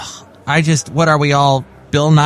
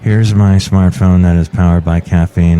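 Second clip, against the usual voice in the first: much lower, louder engineer voice; John Deere voice; 9 semitones lower, 5 dB louder than usual. Acted. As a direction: boom it out low as the engineer. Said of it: "John Deere, the engineer".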